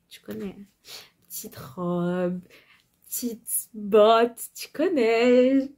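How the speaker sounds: sultry, slow and teasing; slow sultry drawl. Acted. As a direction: stretch every word slowly. Exaggerated sultry fashion talk. (traînée lente et sensuelle)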